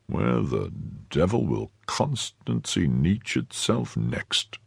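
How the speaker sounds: slow, soft grumbling undertone; murmured. A narrator's reading. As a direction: murmur it slowly to yourself. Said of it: "murmured Athos".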